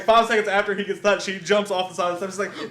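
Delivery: normal talking voice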